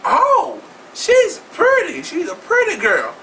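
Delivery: high, slow, gushing "she's pretty"; mock gushing. Imitating fans cooing over Josh in a high voice. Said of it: "She like".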